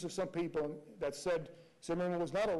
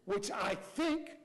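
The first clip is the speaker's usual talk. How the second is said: slow, rising "think"; stress on think; much slower than usual. Shown as a demonstration. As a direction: stretch and hit "think". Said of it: "emphasize think".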